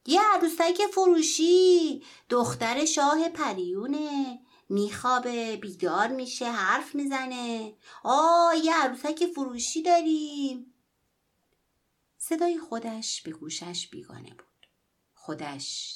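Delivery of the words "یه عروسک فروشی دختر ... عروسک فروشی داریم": loud, high, slow street cry; boy in the snow shouts his wares to the whole street; hawker's shout. Acted.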